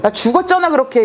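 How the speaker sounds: mock the ghost's furious cry, high; a dead father's indignant outburst, acted high; angry ghost. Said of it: "이런 식으로 막 화를 내셔서".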